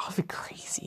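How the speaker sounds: breathy whisper to himself. Real, not acted. Gamer whispering an aside to his friend. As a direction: hush it like a secret. Sudden aside while gaming.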